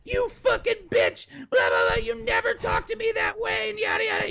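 put-on drunk screaming. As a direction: mimic the sister shrieking from the porch. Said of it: "she's screaming at the top".

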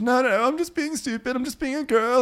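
very high weepy imitation; mock crying. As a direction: wail apologetically in a put-on falsetto. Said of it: "Yeah, really crying".

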